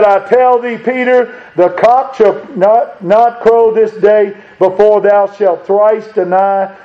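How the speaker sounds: slow solemn quote. Retold or imitated. Quoting Jesus' warning, preached.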